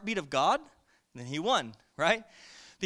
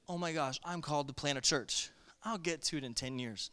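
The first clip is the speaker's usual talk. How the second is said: softer, offhand mock musing; procrastinator's thought; 4 semitones lower, 9 dB quieter than usual. Preacher mocking a lazy believer's thoughts, softer and offhand. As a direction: breezily shrug off the calling.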